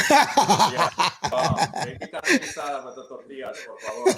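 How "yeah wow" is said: loud laughing yeah; big laugh, much louder and higher. Real, not acted. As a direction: burst out laughing on it. Laughing at mom joke.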